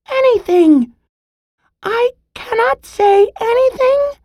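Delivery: very high, strained pleading squirrel voice; squeezed and pleading. Acted. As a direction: squeak out the words while pinned down, short of air. Squirrel pinned, gasping, pleading.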